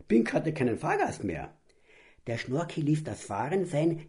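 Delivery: ordinary delivery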